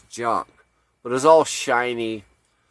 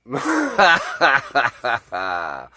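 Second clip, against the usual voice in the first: long burst of laughter. Real, not acted. Storyteller cracking up at his own tale, long laugh. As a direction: let out a long, real laugh.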